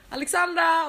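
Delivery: high, loud call across the pool. Retold or imitated. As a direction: shout her name from far away.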